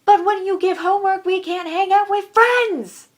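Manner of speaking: high, loud protest, stretched at the end; exasperated outburst. Real, not acted. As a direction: build to an angry yell. Angry rant about homework.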